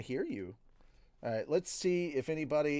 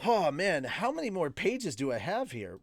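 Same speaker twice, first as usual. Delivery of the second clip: plain reading voice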